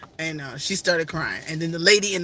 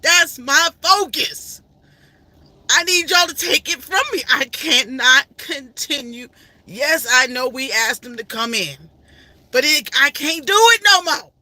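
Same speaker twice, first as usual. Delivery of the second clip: higher, louder, slow exasperated plea; pleading to sister; 6 semitones higher, 5 dB louder, slower than usual. Retold or imitated. Telling her sister she cannot continue, high loud plea. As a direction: throw it back at your sister, pushed to the limit. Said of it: "throwing it back at my sister saying".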